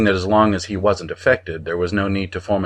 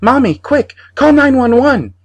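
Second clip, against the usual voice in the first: put-on very high, loud panicked child's yell; boy yelling; 9 dB louder than usual. A narrator's reading. Boy yells to mother for help, very high loud panicked child voice. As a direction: scream for help. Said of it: "Johnny, yelling".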